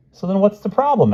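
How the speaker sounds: high-pitched eager questioning; puzzled boy Ricky. A narrator's reading. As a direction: pipe up high like a puzzled kid. Narrator voices the boy Ricky.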